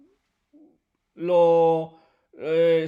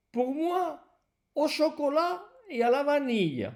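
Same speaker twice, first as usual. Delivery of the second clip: customer voice; 5 semitones higher than usual. Acted. Teacher voices customer Antoine.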